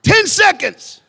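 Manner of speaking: high, loud opening punch on ten; booming opener. Real, not acted. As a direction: grab the room with a big call.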